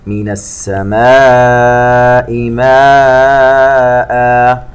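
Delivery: ماء held very long and loud; Quran recitation. Acted. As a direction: stretch ماء in full madd. (تلاوة بمد طويل)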